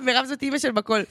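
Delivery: ordinary talk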